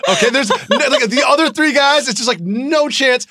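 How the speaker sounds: laughing through the words. Real, not acted. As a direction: giggle while you dismiss them. Laughing while dismissing other guys.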